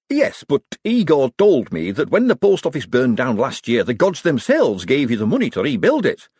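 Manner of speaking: worried man grasping at a solution; anxious Hubert. A narrator's reading. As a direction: babble hopefully in a nervy voice. Hubert pleads, rattling out his hope.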